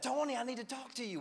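very high, hammed-up excitement; so excited. Excited churchgoer runs up to the pastor, high mock excitement. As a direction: burst in with mock-urgent news. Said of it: "run up to me so excited".

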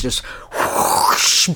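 breathy whoosh of flames; whooshing fire sound. Acted. Imitating Bowser's fire blast, breathy whoosh. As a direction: blow it out like a burst of fire.

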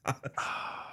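soft breathy sigh. Real, not acted. Sighs over derailed podcast.

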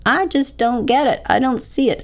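mock-frustrated, pitched high, imitating a confused believer; frustrated doubter. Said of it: "and you're like".

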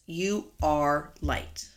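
"You are light" drawn out very slowly, each word weighted; emphatic, very slow. Shown as a demonstration. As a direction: land it word by word. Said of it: "That's an emphatic statement".

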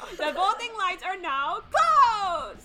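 put-on slow, high continental European accent; hammed-up European announcer accent. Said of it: "I know how to do the, the, the European accent".

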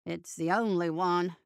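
low, dry sardonic jab. A narrator's reading. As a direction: deflate him with dry sarcasm. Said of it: "said Bo sardonically".

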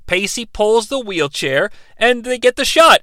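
normal talking voice

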